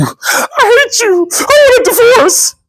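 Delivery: very high, drawn-out wife voice; mock furious wife. Terrified wife snapping at her husband, high mock fury.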